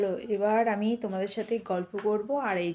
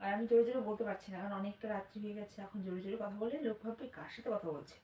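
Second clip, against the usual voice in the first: speaking softly; 12 dB quieter than usual. Real, not acted. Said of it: "সে কারণে আস্তে আস্তেই কথা বলছি".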